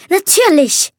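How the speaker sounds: a contemptuous huff; snorting. A narrator's reading. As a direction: scoff as if it were obvious. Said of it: "schnaubte Justine".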